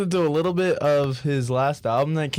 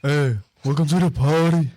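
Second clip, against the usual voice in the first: [name] voice; 2 semitones higher, 4 dB louder than usual. Retold or imitated. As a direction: growl it like a Brooklyn drill rapper.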